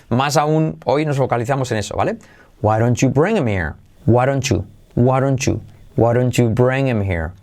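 ordinary talk

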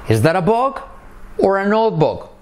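high intonation model. Shown as a demonstration. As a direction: lift the question up high. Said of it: "So repeat after me".

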